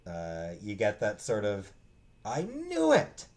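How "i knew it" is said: much higher, slow, drawn-out told-you-so; knowing I knew it. Retold or imitated. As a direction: savor being right all along. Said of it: "uh, sense of feeling".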